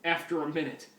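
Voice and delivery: ordinary talk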